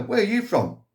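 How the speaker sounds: a high, surprised customer; customer's question. Acted. Customer asking in the joke.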